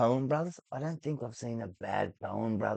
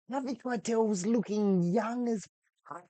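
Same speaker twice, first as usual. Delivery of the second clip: excited gush; 12 semitones higher than usual. Real, not acted. Gushing about actor.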